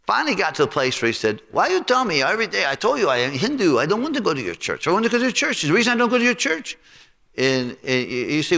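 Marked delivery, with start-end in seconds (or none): 1.5-6.8 high-pitched exasperated restaurant owner; mimicking Bali. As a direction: pitch it up as the fed-up cook.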